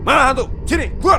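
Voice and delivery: high, loud call into the dark; taunting shout. Acted. Shouting a dare at the haunted house ghosts.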